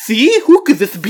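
high, loud, laughing disbelief; mock shock. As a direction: gasp it like a scandal.

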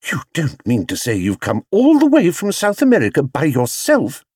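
high-pitched astonishment; exclaimed. A narrator's reading. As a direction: exclaim it, soaring in the middle. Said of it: "exclaimed Missus Brown".

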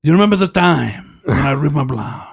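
put-on Spanish accent; hammy Spanish-accented movie quote; Nacho Libre. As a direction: do the bad Spanish accent, stretching the words. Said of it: "like Nacho Libre style".

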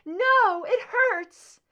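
very high, loud, pained; the pain breaks through; agonized cry. A narrator's reading. Scully crying out to Mulder in agony. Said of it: "a sound of agonizing pain".

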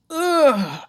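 high, strained creature grunt; beastly groan. Retold or imitated. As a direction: groan like something waking up. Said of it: "when people around us start going".

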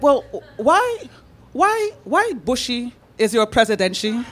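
put-on hesitant question in Brazilian accent; imitated Brazilian accent. Imitating Brazilian friends' English questions.

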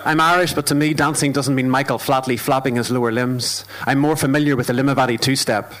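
steady rhythmic poetry performance; spoken word poem. Acted. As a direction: perform it like a stage poem, measured and deliberate.

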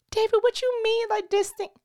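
high, incredulous questioning; indignant. Real, not acted. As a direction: pitch up in mock outrage. Mock-indignant questioning David.

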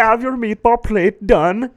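high, loud hammed-up Swedish waiter; mock Swedish accent. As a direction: play a jokey Swedish cook serving meatballs. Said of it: "Swedish dude".

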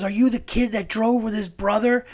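high-pitched imitation of an astonished woman; amazed recognition. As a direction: act out her sudden recognition. Retelling judge's amazed recognition.

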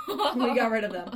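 laughing loudly. Real, not acted. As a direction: crack up at your own joke. Laughing after joke.

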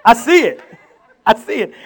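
loud, high, laughing cry of discovery; excited shouting. Retold or imitated. Preacher reliving his dream, shouting excitedly with laughter. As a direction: shout it out, thrilled to finally see it. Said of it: "I'm excited".

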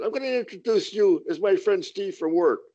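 hammed-up wife giving orders; voicing the wife.

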